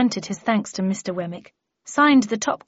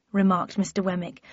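usual speaking voice

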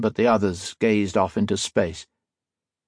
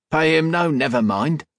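rough working man; 7 semitones higher than usual. A narrator's reading. Rough man voiced in story.

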